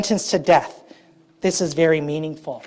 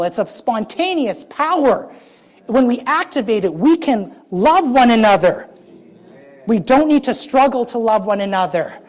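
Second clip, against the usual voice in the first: fervent preaching; 3 semitones higher, 4 dB louder than usual. Acted. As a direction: stretch each word out to the congregation.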